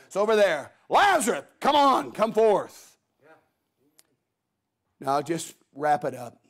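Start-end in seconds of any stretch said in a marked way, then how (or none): 0.9-3.0 raised, commanding call. Acted. Preacher as Jesus summoning Lazarus from the grave, loud call. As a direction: call someone out from a distance, punching each phrase.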